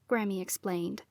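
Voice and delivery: usual speaking voice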